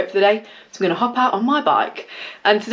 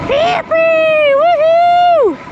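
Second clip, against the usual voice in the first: very high, loud yippee and woohoo; gleeful cheer; 6 dB louder, much slower than usual. Real, not acted. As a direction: shout for joy at the top of your voice. Cheering freely while riding.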